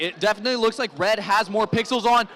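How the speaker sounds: normal talking voice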